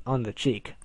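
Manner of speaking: usual speaking voice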